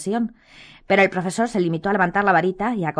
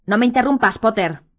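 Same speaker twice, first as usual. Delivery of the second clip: sharp, higher and louder warning; menacing; 4 semitones higher, 6 dB louder than usual. A narrator's reading. Snape silencing Harry's interruption, menacing and loud. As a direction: cut him off with a threat. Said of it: "dijo Snape con una voz amenazadora".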